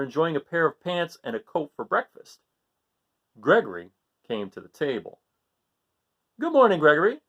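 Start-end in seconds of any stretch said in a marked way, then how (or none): 6.4-7.2 cheerful parents. Acted. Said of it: "said Father and Mother Goat".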